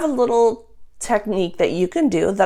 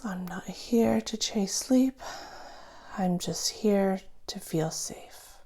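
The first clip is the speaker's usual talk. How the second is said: slow, softer whispered mantra; 12 dB quieter, slower than usual. Shown as a demonstration. Coach guides listener through exhale, whispered slowly. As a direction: breathe the words out in a hush. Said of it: "say that in your mind".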